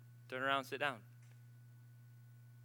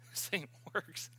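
laughing; 2 semitones higher, 6 dB quieter, slower than usual. Retold or imitated. Retold reaction, laughing.

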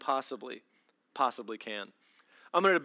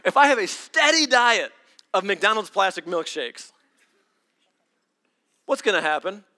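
loud, louder preacher build; preaching loud; 4 semitones higher, 7 dB louder than usual. Acted. Pastor preaching hard to the congregation, loud. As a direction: drive it home from the pulpit.

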